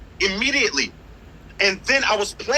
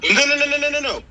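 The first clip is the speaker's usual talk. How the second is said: rapid-fire string of higher-pitched no's; urgent objection; 5 semitones higher, 3 dB louder, faster than usual. Real, not acted. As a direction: cut in hard to stop them. Cuts in to dispute the claim.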